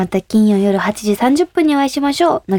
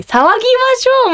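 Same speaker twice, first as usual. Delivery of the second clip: polite invitation; 14 semitones higher, 3 dB louder, much faster than usual. Retold or imitated. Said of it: "も違うし".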